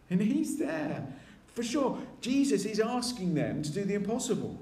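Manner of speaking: normal talking voice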